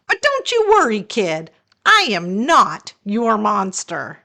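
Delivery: high, fast sassy monster with attitude; a diva monster scolding a kid, high and quick. Acted. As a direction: lecture the kid with swagger.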